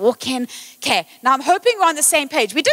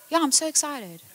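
deadpan; 8 dB quieter than usual. Retold or imitated. Husband's deadpan reply.

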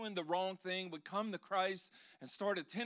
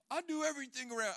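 imitating my kids; 7 semitones higher, faster than usual. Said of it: "I hear my kids say".

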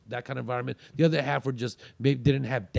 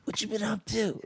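hushed, higher-pitched hello; casual greeting; 6 semitones higher, 7 dB quieter than usual. Retold or imitated. Friend greeting him while busy in bed, hushed casual greeting. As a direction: greet a pal under your breath, voice lifted.

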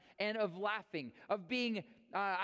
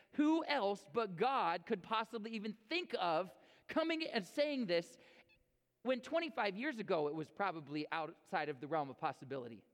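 ordinary talk, nothing marked

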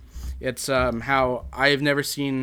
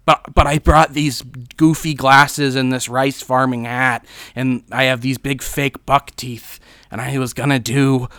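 Jack Nicholson; 6 dB louder than usual. Acted. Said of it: "And Jack's like".

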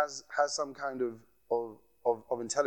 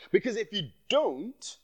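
slipping into a Nigerian accent, higher and slower; 5 semitones higher than usual. Real, not acted. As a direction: let the Nigerian accent come out as passion builds. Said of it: "I slipped into my Nigerian accent there".

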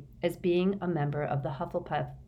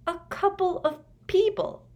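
stunned echo; 4 dB louder than usual. A narrator's reading. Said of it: "said Harry hoarsely".

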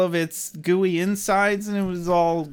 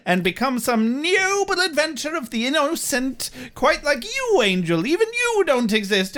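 high-pitched mock outrage, rushing then stretching it out; indignant Wesley; 8 semitones higher than usual. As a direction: act out a scandalized, high-voiced protest. Acting Wesley's indignant outburst.

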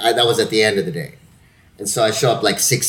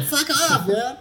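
higher, laughing fuck-off; laughing defiance; 13 semitones higher than usual. Retold or imitated. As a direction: toss it back with a laugh. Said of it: "I'm like".

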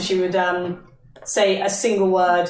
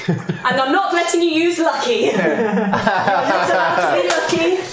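laughing while teasing, higher pitch; laughing tease; 4 semitones higher than usual. Real, not acted. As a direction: giggle through the taunt.

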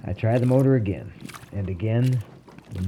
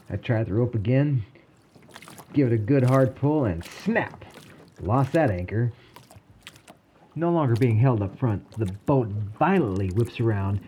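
usual speaking voice

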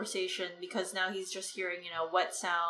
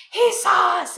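high, loud shout of being caught; sudden acted yell, pitched way up; alarmed outcry; 10 semitones higher, 12 dB louder than usual. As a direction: cry out in panic as the character. Retold quote, couple caught by Bran.

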